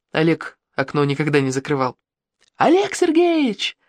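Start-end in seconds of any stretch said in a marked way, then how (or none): 2.6-3.8 high, slow, stretched call through a window; acted shout. A narrator's reading. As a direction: call the name out long and high. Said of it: "закричал я в окно". (протяжно кричит в окно, высоким голосом)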